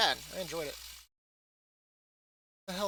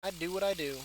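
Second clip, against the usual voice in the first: quiet muttered repeat; 9 dB quieter than usual. Retold or imitated. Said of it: "And I'll be like".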